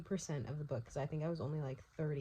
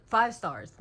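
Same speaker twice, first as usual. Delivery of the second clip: a loud, high, joyful cry of five stars; real excitement bursting out; thrilled exclamation; 6 semitones higher, 12 dB louder than usual. Real, not acted. Right after finishing the book, she cries out to viewers, thrilled and loud.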